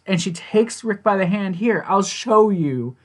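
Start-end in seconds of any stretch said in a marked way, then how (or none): 1.6-3.0 higher, slow, lightly voiced grandmother; sweet old lady. Retelling a grandmother leading the officer by the hand, sweet old-lady voice. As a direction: offer help as a kindly grandmother. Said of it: "it's so freaking sweet".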